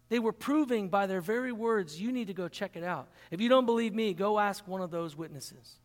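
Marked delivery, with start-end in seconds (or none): none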